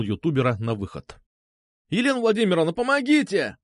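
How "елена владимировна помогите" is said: high-pitched cry for help; wailing plea. A narrator's reading. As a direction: wail to the teacher for rescue. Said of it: "заголосил он". (голосить, звать на помощь)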